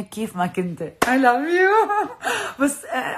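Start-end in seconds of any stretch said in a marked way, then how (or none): 1.0-2.0 much higher and louder I love you, stretching "you"; thanking the designer, a loud high gushing I love you. Real, not acted. Gushing to Haytham. 2.2-3.2 real laugh, voice still high. Real, not acted. Laughs after gushing.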